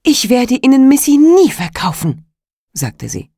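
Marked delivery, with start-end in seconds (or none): 0.0-2.2 loud, grim refusal; defiant. A narrator's reading. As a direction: glare back and refuse. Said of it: "so finster sie konnte". (finster und trotzig)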